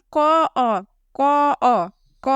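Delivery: "ক, অ" split apart, each sound held alone; consonant plus vowel. Shown as a demonstration. Teacher showing ক depends on a vowel, sounds separated. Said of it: "ক কিন্তু স্বাধীন নয়".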